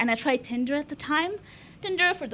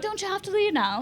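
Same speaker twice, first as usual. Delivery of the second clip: high-pitched mock English accent; 7 semitones higher than usual. Comic repeating the taxi driver's question, put-on English accent. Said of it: "That's my best English accent".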